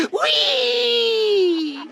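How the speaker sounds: long, very high squeal of glee; gleeful whoop. Acted. Villain along for the ride, high gleeful whoop.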